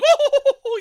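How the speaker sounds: loud, high burst of laughter; hearty high laugh; big laugh. Retold or imitated. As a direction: laugh hard at the joke. Presenter joke, audience laughing.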